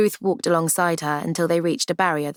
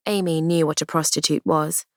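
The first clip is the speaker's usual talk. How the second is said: plain reading voice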